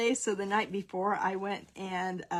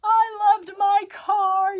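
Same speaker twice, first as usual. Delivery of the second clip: higher, louder, slow mock wail; mock bawling; 12 semitones higher, 10 dB louder, slower than usual. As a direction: wail it like a heartbroken teen, hammed-up. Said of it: "she's just sitting there bawling".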